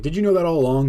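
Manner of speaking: surprised. Retold or imitated. Caught out by a coworker.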